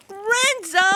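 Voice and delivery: high, loud call of the name; frustrated shout. Acted. Girl shouting as dog ruins her project. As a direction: yell it in dismay.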